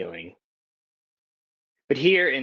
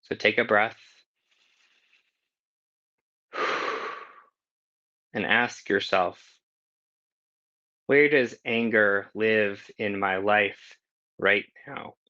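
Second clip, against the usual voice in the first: guided meditation question. Acted. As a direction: let the question settle over them.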